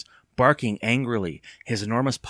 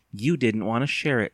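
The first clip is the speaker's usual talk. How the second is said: ordinary delivery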